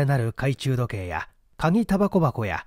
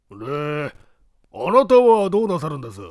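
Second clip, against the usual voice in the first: raised, louder asking voice; henchman's question; 4 dB louder than usual. Acted. Henchman asks the boss, raised and louder. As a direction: ask the boss what he'll do next.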